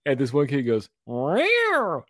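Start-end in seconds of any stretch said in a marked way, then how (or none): 1.1-2.0 high yowling meow, mock catty; cat-call meow. Kid heckling a stern teacher, catty meow. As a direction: imitate a kid's cheeky cat yowl. Retold pupil's cat noise in class.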